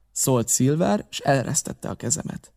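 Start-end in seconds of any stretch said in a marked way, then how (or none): none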